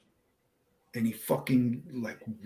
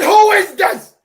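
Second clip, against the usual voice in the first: much higher, very loud demand, a notch up; louder indignant yell; 14 dB louder than usual. Retold or imitated. Firefighter yelling back at the voice, louder. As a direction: really yell it this time. Repeated, angrier retold demand.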